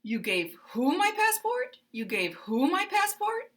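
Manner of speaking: You gave who my passport rising high on who and staying up. Shown as a demonstration. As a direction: jump up on who and hold it high. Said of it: "rise, and then stay up".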